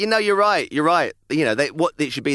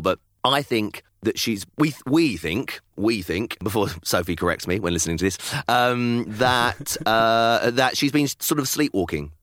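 ordinary delivery

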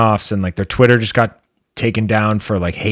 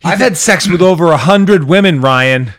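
indignant boast; 8 semitones higher, 5 dB louder than usual. Pretend. Defiant mock boast to Ryan Gosling.

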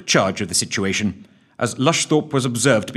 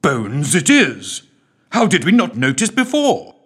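put-on excited old lord; 8 semitones higher, 6 dB louder than usual. A narrator's reading. Lord's excited outburst.

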